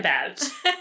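ordinary talk

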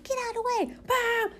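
high, sharp shout at drivers, hammed up; mock road rage. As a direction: yell at the drivers, half joking. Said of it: "I was like".

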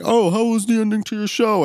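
high, mock-chirpy question; imitated dad. Son imitating his dad asking about the finale, high mocking voice. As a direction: ask it in a high teasing voice. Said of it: "my dad's like".

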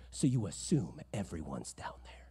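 breathy hushed whisper. Real, not acted. The preacher whispering his point to the congregation. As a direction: lean in and whisper it.

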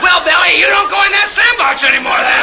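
high loud scolding mother; mom voice. Retold or imitated. Mom answers the child.

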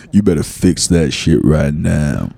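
low, slow stern father; stern dad voice. Retold or imitated. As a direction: scold slowly and low.

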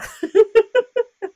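high, loud burst of laughter. Real, not acted. Host amused at her guest's three names, laughing. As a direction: laugh out loud, pitched well up.

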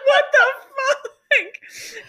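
very high, drawn-out squeal through laughter; real incredulous laughter. Real, not acted. Friend reacting to costumed dating show, helpless laughing. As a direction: squeak it out while cracking up.

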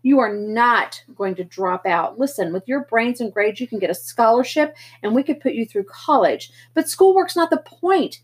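big brother shouting at Pony; angry older brother. Acted. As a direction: lay down the law, furious. Darry yells back in argument.

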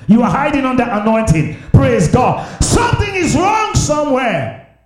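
high, loud shouted preaching; fiery preaching. Real, not acted. Pastor shouting rebukes at the congregation, fiery preaching. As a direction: hammer each line home to the congregation.

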